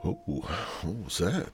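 ordinary delivery

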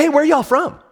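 high, bright call across the shop; eagerly calling out to a stranger; excited greeting. Retold or imitated. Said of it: "I say".

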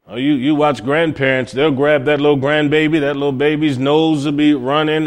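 ordinary delivery

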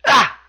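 short, high, loud yelp; one sudden punched "Ah!" in imitation; Tozawa's "Ah!" cry. Wrestler answers into the mic, short high imitated yelp. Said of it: "Tozawa simply says into the mic".

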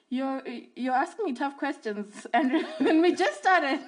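laughing off a tough question from Andrew; laughing protest. Real, not acted. Laughing at the host's hard opening question, laughing.

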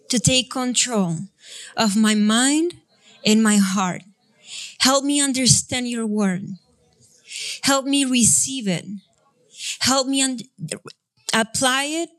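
normal talking voice

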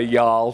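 English speaker forcing "y'all" as one stretched syllable; awkward y'all. Shown as a demonstration. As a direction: say "y'all" like it feels strange in your mouth. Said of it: "I sound strange if I try to say".

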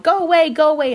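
high, loud shoo; shooing shout. A narrator's reading. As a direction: shout the cow away.

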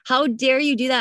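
high, slow indignant cry; retold anger. She retells confronting the doctor, in high indignant disbelief. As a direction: hurl it back at the doctor, pitched high. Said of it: "I was so angry at that doctor".

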